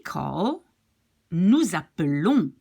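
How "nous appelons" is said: "appelons" with the final -lons stressed; stress after the L. Shown as a demonstration. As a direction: land firmly on -lons in "appelons". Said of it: "The accent is after".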